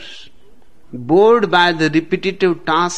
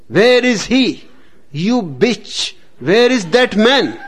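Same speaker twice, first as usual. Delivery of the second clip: angry husband; 5 semitones higher than usual. Acted. Enraged husband shouts at wife.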